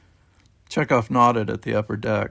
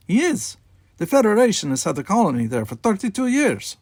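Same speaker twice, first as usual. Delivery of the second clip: put-on Russian accent; 10 semitones higher, 4 dB louder than usual. A narrator's reading. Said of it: "His thick Russian accent".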